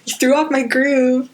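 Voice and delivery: playful quoted complaint; acted movie quote. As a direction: complain like an offended cartoon emperor. Quoting Emperor's movie line.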